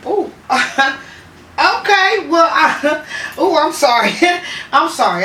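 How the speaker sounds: laughing, embarrassed apology; giggling through sorry; nervous laughing. Real, not acted. Laughs awkwardly after call.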